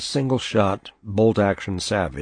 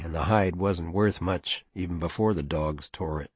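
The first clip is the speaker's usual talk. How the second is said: plain reading voice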